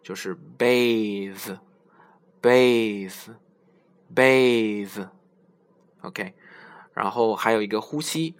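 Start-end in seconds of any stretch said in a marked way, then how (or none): 0.6-5.1 bathe drawn out slowly with a voiced th; teacher modelling bathe; slow model word. Shown as a demonstration. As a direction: repeat bathe very slowly, buzzing the th. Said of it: "就是另一种了".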